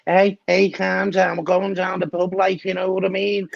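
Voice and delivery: high-pitched comedy-sketch Scouser; Scouser accent. Acted. Cruiser asked by host to do Scouser, mock Scouse accent. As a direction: put on a high, excitable Liverpool accent. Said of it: "Can you do the accent?".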